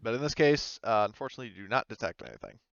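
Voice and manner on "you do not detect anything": ordinary delivery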